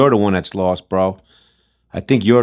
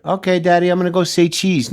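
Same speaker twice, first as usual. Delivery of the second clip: mock little daughter; 7 semitones higher than usual. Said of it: "Is my daughter supposed to learn".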